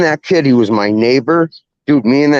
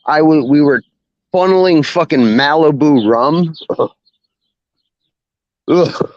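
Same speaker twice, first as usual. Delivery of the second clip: usual speaking voice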